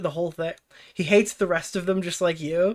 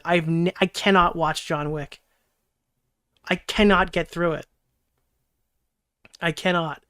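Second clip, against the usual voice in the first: ordinary talk, nothing marked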